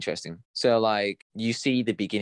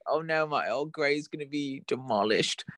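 higher, hammed-up posh lament; mock posh accent; 5 semitones higher than usual. Co-host mourning Earl Grey in jest, mock posh accent. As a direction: play a distraught tea lover with posh vowels. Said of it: "posh accent".

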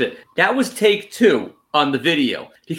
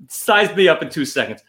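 ordinary talk, nothing marked

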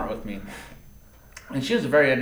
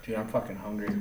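normal talking voice

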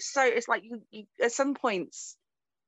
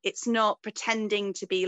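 ordinary talk

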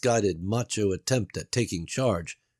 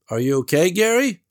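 higher, louder doubtful Beverly; Beverly's voice; 10 semitones higher, 7 dB louder than usual. A narrator's reading. Beverly doubts Gary's escape plan, worried and raised. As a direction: voice her worry, raised.

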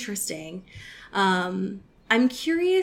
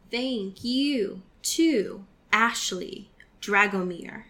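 ordinary delivery

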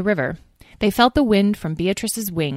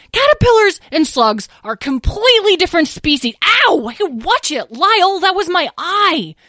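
indignant yelp; 12 semitones higher, 5 dB louder than usual. A narrator's reading. Fight continues, hit in eye.

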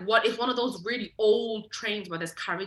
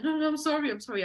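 quick pleading, starting high then dropping; mock-plead, repeating the apology; imitated begging; 7 semitones higher, faster than usual. Thief caught in the cabin begging, mock pleading. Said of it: "like, begging".

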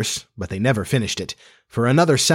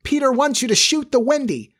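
shrill, high-pitched fairy voice; Tinker Bell; 11 semitones higher than usual. A narrator's reading. Fairy gives boys a false order, shrill high voice. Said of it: "the shrill voice of Tinker Bell".

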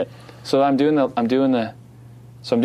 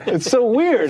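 laughing; 12 semitones higher, slower than usual. Real, not acted. Laughing after telling the story.

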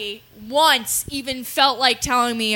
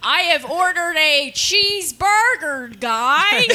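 slow, higher, badly faked American accent; British actor's American accent; 5 semitones higher, slower than usual. British actor ordering food, badly faked American accent. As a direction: overdo an American accent as a Brit would. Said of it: "British actors are trying to do an American accent".

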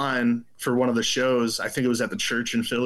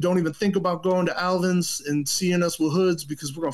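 higher-pitched, menacing retold threat; Joe Hardcore; 6 semitones higher than usual. Joe Hardcore warns the teenager off the shows, threatening. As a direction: imitate a tough caller issuing a warning. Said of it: "And he's like".

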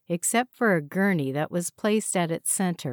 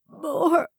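1 strained plea; 10 semitones higher, 3 dB louder than usual. A narrator's reading. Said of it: "Franklin strained to get the words out".

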